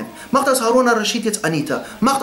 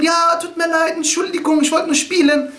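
put-on high, childlike apology; Bahlul; 8 semitones higher than usual. The madman excuses himself to the angry caliph, childishly apologetic. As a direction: whine an excuse in a raised pitch. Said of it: "Bahlul, der ist so".

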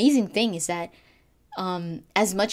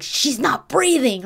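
mock-worried cry, higher and louder; imitated worry; 6 semitones higher, 5 dB louder than usual. Fan retelling Garrus's line from the scene, worried. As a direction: imitate an alarmed, worried man. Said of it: "heard Garrus that concerned, that worried".

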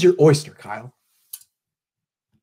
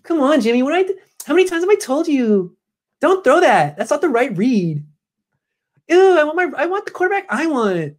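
whiny Kyle; 11 semitones higher than usual. Retold or imitated. Kyle scolding Jimmy in the QB room, high whine. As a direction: scold the quarterback like a sulking kid. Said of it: "does Kyle whine like that in the Q B room".

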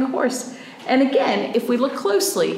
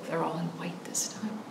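whisper; 3 semitones lower, 12 dB quieter than usual. Pretend. Night scene, hushed aside.

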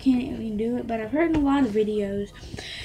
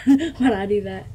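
laughing overreaction; 3 semitones lower than usual. Real, not acted. Kid laughs at his own overreaction. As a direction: laugh at your own outburst. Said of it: "Sorry, I just overreacted".

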